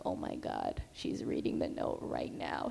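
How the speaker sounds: low, hushed, panicked whisper; nervous whisper. Retold or imitated. Nurse watching the mom read her note, in a panicked whisper. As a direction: whisper as if she might overhear.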